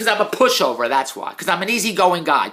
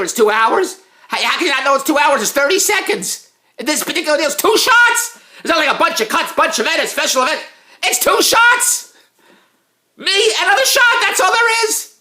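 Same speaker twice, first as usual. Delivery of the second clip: high, louder, disbelieving; exasperated rant; 10 semitones higher, 4 dB louder than usual. Real, not acted. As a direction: build to a squeaky outraged peak. Exasperated rant rising to squeal.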